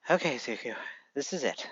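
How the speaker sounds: low, quiet inner thought; bracing himself. A narrator's reading. Izuku bracing himself before knocking on the door, low and quiet. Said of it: "he takes a deep breath thinking".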